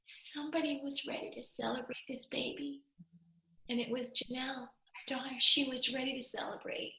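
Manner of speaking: high, soft voice through tears; real choked-up tenderness; tearful. Real, not acted. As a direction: talk while crying a little. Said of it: "Sorry for crying a little bit".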